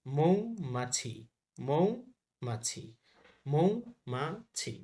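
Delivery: মৌমাছি drawn out as "mou-ma-chhi"; very slow, split মৌমাছি into its syllables; slow syllable drill. Shown as a demonstration. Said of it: "ভালোভাবে পড়তে হবে". (মৌমাছি ধীরে ভেঙে "মৌ-মা-ছি")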